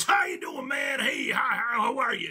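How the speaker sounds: imitating a Texan, called out high and strong; deep-voiced Texan. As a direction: holler a hearty Texan greeting in mimicry. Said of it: "with this deep voice".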